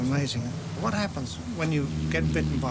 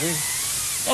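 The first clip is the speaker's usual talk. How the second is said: ordinary talk, nothing marked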